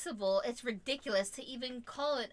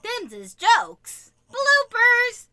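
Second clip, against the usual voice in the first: loud, drawn-out silly announcement; mock-jolly exclamation; hammed-up blooper shout; 12 semitones higher, 16 dB louder, much slower than usual. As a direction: holler it out slowly for the outtakes.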